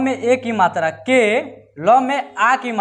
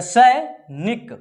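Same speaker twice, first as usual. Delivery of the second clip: drawn out slowly; 2 semitones higher, much slower than usual. Shown as a demonstration. Teacher showing pupils how to sound out सैनिक, slowly. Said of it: "sound पूरा निकाल कर पढ़ेंगे".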